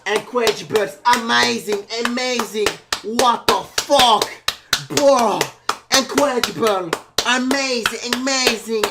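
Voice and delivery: loud, stretched, over-the-top praise; hammed-up amazement. Cheering the cuber in English, hammed-up amazement. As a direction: shout each word as huge mock hype.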